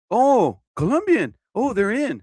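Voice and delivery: high-pitched mock-excited exclamation; imitated excitement. Cook reacting to a Colombian, imitated excitement.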